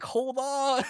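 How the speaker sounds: high, laughing mock call; playful high-pitched holler to wait. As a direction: yell after someone, half laughing. Calling from behind while fleeing.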